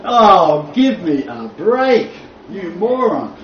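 slow, drawn-out exasperation; loud groaning "oh", then slow disbelief; exasperated retort. Retold or imitated. Teacher retelling his reaction to the article, exasperated. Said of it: "And I'm going".

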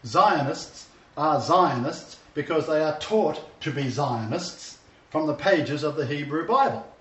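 normal talking voice

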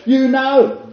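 high, exasperated "You know". Retold or imitated. Peter turning around on Yeshua, exasperated. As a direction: throw it back, fed up. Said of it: "it's exasperation".

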